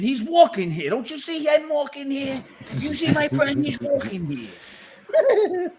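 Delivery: mock New Yorker. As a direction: do a New York cabbie yelling he's walking here.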